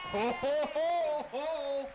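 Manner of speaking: big rising ho-ho-ho; Santa belly laugh. Pretend. Santa announces himself at the door, jolly ho-ho-ho. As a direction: boom it out as Santa.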